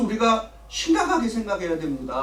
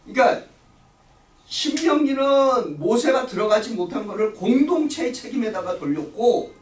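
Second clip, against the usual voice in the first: ordinary talk, nothing marked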